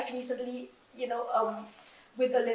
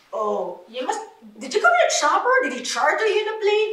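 sarcastic; 6 semitones higher, 5 dB louder than usual. Retold or imitated. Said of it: "I was so sarcastic".

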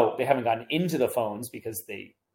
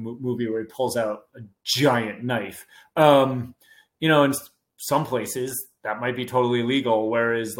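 ordinary talk, nothing marked

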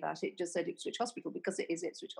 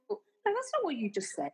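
high-pitched, fast-rising outrage in retelling; incredulous protest, pitched right up; indignant retort; 11 semitones higher, faster than usual. Retold or imitated. Retold indignant silent reply to manager.

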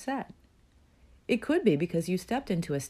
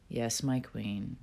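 resigned; 5 semitones lower, 5 dB quieter, slower than usual. Retold or imitated. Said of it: "softening a bit now and still somewhat frustrated".

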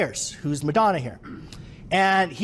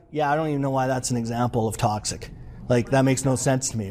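put-on older worker's voice; 7 semitones lower than usual. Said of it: "they'd be like".

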